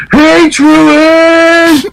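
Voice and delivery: high, drawn-out mock holler; long, high-pitched teasing call; imitated holler. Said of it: "somebody on the freaking computer decides to holler".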